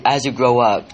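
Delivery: ordinary delivery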